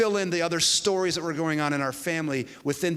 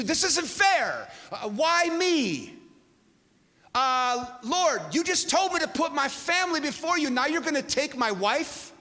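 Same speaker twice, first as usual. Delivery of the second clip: anguished protest; 10 semitones higher than usual. Retold or imitated. Husband to God about losing his wife, anguished protest. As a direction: wail it as a stretched, wounded complaint.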